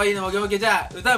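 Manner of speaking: host the show with bright energy; radio host. Acted.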